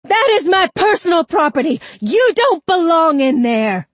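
high, loud screaming outburst; enraged scream. A narrator's reading. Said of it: "as he screamed".

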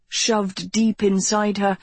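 usual speaking voice

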